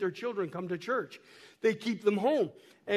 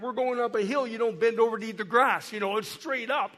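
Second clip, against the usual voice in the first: normal talking voice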